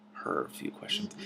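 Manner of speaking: high unvoiced whisper, slow; soft whisper stressing "Her"; whispered correction. Real, not acted. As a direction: breathe "Her a few questions" as a hushed slow fix.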